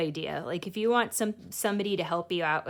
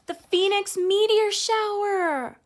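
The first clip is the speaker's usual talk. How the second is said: much higher, louder, slow thrilled cry; amazed shout; 14 semitones higher, 8 dB louder than usual. A narrator's reading. Girl cries out as the meteor shower begins, amazed shout. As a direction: announce the wonder with awe.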